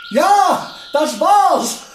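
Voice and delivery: high, loud cry of victory; triumphant shout. Acted. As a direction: shout it out, it worked. Experiment succeeds, triumphant cry. (triumphierender Ausruf, hoch und laut)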